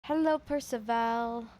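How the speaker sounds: ordinary talk, nothing marked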